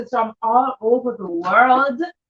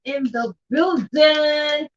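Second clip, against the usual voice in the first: drawn-out hype shout; 2 semitones higher, much slower than usual. Real, not acted. Announcing guests in the stream.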